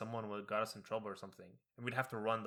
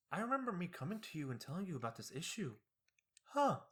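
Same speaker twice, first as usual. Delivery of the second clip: high, musing mock-innocent mom; wondering aloud in a put-on high voice; mom feigning innocence; 10 semitones higher than usual. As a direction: play a mom pretending to be puzzled. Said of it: "She'd be like".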